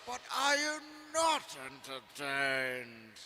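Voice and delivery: slow shouted arena challenge; gladiator shout. Retold or imitated. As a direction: roar it at the crowd. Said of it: "gladiator movie quote".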